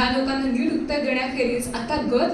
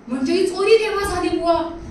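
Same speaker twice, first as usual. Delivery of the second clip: higher, mock astonishment; surprised; 4 semitones higher than usual. A narrator's reading. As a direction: feign surprise about the theft. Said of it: "आश्चर्याने त्यांनी विचारलं".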